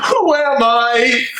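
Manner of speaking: a man who no longer knows himself; despairing question. Acted. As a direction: ask it slowly into the dark.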